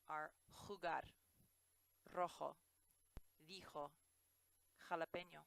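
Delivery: jugar, rojo, dijo with a throaty J; Spanish J. Shown as a demonstration. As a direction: rasp the J in the throat. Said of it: "hear it in your throat".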